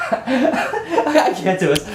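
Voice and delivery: laughing through I can't do it, high-pitched; giggling, can't hold it together; real laughter breaking in. Real, not acted. Laughing off his failed impression.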